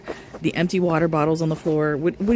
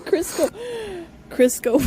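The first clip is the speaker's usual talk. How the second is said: high, laughing name repeat; giggly mock repeat; amused repeat; 12 semitones higher than usual. Real, not acted. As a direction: savour the silly name. Mocking a super chat name.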